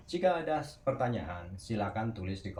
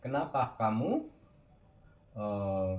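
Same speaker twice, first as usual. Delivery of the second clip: ordinary delivery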